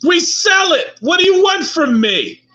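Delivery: furious high-pitched shouting; ranting villager. Acted. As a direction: yell it like a fed-up peasant at his wits' end. Villager rants at party.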